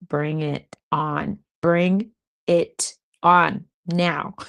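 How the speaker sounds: slow, stretched out "Bring it on now"; drawn-out bring it on. Shown as a demonstration. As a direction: draw out "Bring it on now" as a dare. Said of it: "repeats it slower".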